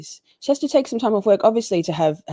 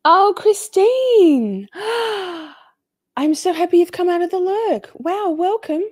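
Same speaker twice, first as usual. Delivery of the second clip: high, drawn-out delighted greeting; excited welcome; 12 semitones higher, 4 dB louder than usual. Real, not acted. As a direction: gush over the new viewer.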